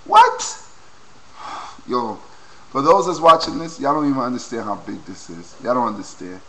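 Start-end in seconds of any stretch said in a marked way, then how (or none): 0.0-0.7 squeaky high what; surprised. Real, not acted. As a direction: jump way up in disbelief. Mock disbelief.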